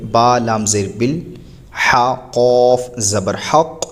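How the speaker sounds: slow drawn-out spelling of syllables, harf by harf; spelled-out Quran reading. Shown as a demonstration. Teacher spelling the word slowly for students. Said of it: "اس طریقے سے پڑھیں گے".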